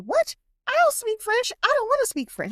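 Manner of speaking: high-pitched retold protest; indignant disbelief. Woman answers friend's French accent joke, indignant disbelief. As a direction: protest the very idea, voice jumping way up.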